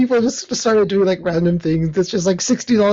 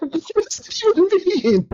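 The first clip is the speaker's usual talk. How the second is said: high, slow baffled question; can't make sense of it at all; baffled disbelief; 11 semitones higher, slower than usual. Retold or imitated. Retelling his own confused reaction to the casting news, baffled. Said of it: "And I'm like".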